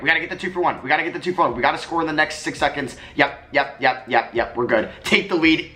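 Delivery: ordinary talk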